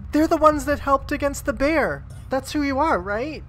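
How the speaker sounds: high, eager voice; excited halfling. Acted. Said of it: "They're like".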